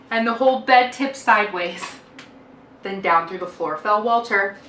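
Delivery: usual speaking voice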